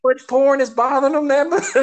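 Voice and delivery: laughing incredulous question. Real, not acted. To a friend about the ballot, laughing in disbelief. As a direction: laugh as you ask it.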